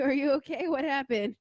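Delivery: ordinary talk, nothing marked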